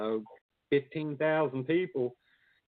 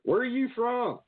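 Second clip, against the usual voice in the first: imitating outsiders; 11 semitones higher than usual. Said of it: "they're like".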